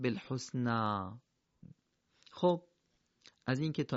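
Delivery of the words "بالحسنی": slow بالحسنی, final vowel drawn out; pausal reading. Shown as a demonstration. Teacher answering his own question with the reading. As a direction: lengthen the end of بالحسنی gently. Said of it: "چی باید بخونیم".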